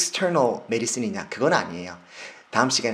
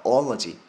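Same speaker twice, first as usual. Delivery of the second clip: "ology" with the first O stressed as a long "ah"; 4 dB louder, much slower than usual. Shown as a demonstration. Teacher giving students the suffix, stressed O. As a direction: punch the opening "ah" in "ology", louder. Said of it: "O에 강세가 붙으면 아 소리로".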